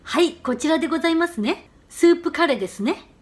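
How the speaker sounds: slow, each ね lifted briefly at the end; confirming ね. Shown as a demonstration. Teacher showing a clerk's check, short rising ね. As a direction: tip the ね of ですね slightly upward. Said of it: "끝을 짧게 위로 살짝 올리세요".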